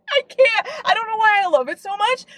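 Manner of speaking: high, laughing squeal of delight; giddy laughing. Real, not acted. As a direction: laugh it out, too giddy to stay calm. Gushing laugh over book trope.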